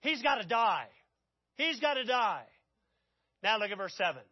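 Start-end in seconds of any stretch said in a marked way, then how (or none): 0.0-0.9 loud angry outburst; enraged verdict. Acted. King David erupting at Nathan's story, loud and angry. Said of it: "he cannot contain himself". 1.6-2.5 loud, insistent angry repeat; repeated enraged verdict. Acted. King David insisting again to Nathan, loud. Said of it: "he cannot contain himself".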